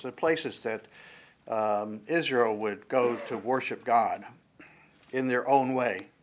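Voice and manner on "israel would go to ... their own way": ordinary talk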